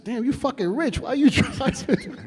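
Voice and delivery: high, laughing disbelief; laughing tease. Retold or imitated. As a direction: tease her through a laugh. Teasing Janet, laughing.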